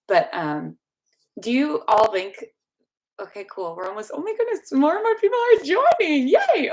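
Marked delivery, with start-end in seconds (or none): 4.2-6.7 excited cheer. Real, not acted. Celebrating more viewers joining.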